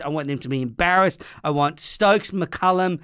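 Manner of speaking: ordinary talk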